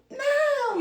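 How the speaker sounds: high, loud refusal; child's shout. Pretend. Toddler refusing a bath, shouting loud and high. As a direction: yell no like a stubborn toddler.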